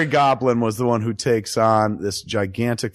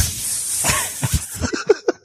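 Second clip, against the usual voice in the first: high breathy laugh. Real, not acted.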